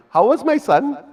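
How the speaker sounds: high, eager proud mother asking about her son; proud mom asking. Retold or imitated. Parsi mom asking people at interval.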